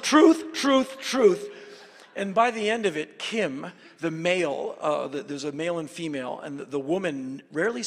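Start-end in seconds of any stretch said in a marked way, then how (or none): 0.0-1.5 high, loud insistent repeats; mock pleading innocence. Tourist insisting to the minders it's the truth, high and loud. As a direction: plead it's true, over and over. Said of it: "And I was just".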